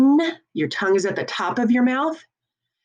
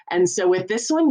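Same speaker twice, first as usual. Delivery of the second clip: ordinary talk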